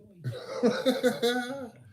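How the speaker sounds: very high, loud cackle; laugh. Real, not acted. Fan cracking up at an old catchphrase, high loud laugh.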